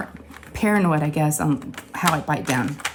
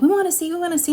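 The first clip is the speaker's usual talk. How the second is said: put-on much higher, eager children's chant; eager kids; 11 semitones higher, faster than usual. Daughters begging to see the chipped tooth, eager. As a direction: play the kids begging to look.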